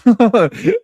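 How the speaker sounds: high, loud real laugh; big laugh. Real, not acted. Laughing at the crows goodbye line, loud and high. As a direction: burst out laughing.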